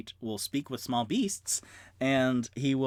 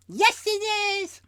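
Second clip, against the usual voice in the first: much higher, loud squeak; rat voice; 5 dB louder than usual. Acted. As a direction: Waddles chirps agreement. Waddles answers.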